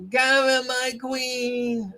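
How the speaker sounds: put-on high, drawn-out drunk wail; drunk hecklers imitated. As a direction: mimic a drunk fan cheering, high and stretched. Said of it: "The hagbag drunks from Quincy and the South Shore".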